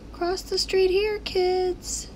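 high, bright-voiced crossing-sign kid; high crossing-sign voice telling kids to cross; sign character voice. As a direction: call out like a friendly street sign. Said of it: "That's the sign talking".

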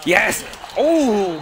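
loud, high celebration; excited cheer. Real, not acted. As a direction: shout it as the play succeeds. Good play.

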